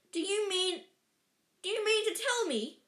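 high, incredulous anger; angry growl. A narrator's reading. Giant confronting the Dursleys, growling in anger. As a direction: demand it, pitched well up. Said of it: "he growled at the Dursleys".

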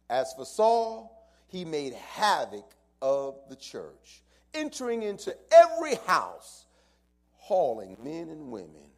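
loud, high, slow preaching climb; preaching at full force. Acted. Pastor telling the church of Saul's havoc, loud preaching. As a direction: build the fire on Saul's havoc.

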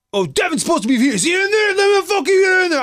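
high, loud, fast mock rant; mock angry demand. Jealous husband demands to get inside, high loud mock rant. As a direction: push past like a furious drunk. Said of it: "he's like".

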